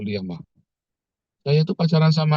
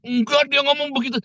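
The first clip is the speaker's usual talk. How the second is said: high-pitched imitated contradiction; acted-out stubborn protest; mock insistence; 13 semitones higher than usual. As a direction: argue back, voice pitched way up. Acted dispute over what was said.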